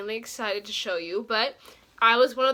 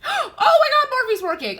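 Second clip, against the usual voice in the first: gasping, high and loud with joy; excited gasp; 10 dB louder than usual. Real, not acted. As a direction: burst out with a delighted gasp. Site finally loads after failing.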